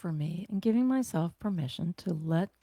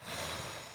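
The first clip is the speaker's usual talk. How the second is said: unvoiced blown-out breath; long soft exhale through the mouth; clearing breath out; 11 dB quieter than usual. Shown as a demonstration. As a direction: blow it out like birthday candles. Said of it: "blow out birthday candles through the mouth".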